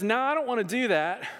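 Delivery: high-pitched joking refusal as Jonah; mock refusal. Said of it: "That's exactly how he said it".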